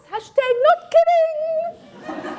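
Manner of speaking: high, slow, laughing mock-grand aside; laughing theatrical aside. To the children after Shakespeare advice, laughing theatrical aside. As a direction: ham it up through a laugh.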